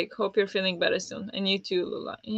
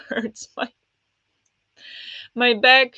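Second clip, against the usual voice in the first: usual speaking voice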